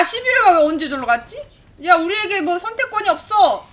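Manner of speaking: ordinary talk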